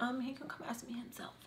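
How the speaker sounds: whispered, seductive version; flirty whisper. Shown as a demonstration. Showing how it would sound as flirting, whispered. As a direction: breathe it as a flirty hint. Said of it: "It would be different if I was like".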